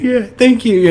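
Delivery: high, loud eager thanks; gushing thanks. Retold or imitated. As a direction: blurt it out like an eager fan. Mocking the Ant-Man scene.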